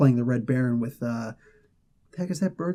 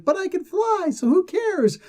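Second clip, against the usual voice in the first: much higher, carefree squeal; giddy. Retold or imitated. Carolina flying around, squealing with carefree joy. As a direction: toss it off in sheer delight. Retold girl delighted at flying.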